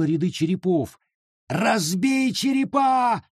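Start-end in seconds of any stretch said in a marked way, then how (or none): 1.5-3.2 very high, slow, desperate shout; desperate yell. Acted. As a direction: scream the order in despair. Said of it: "отчаянно завопил лес".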